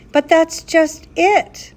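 desperate. A narrator's reading. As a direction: plead it desperately, voice lifting. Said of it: "the professor replied desperately".

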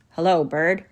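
put-on gruff, embarrassed boy's greeting. A narrator's reading. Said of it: "said in a gruff voice".

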